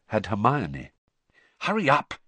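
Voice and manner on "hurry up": tense. Acted. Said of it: "she said tensely".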